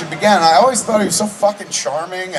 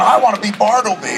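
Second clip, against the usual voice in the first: imitated eagerness, pitched up; eager friend; 7 semitones higher than usual. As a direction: play a friend keen to get the role. Retelling friend eager for the role.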